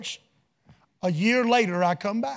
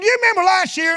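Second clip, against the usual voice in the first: high, lifted voice of the lady; lady recalling; 11 semitones higher, 7 dB louder than usual. Retold or imitated. Lady asking the preacher, high and lifted. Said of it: "She said".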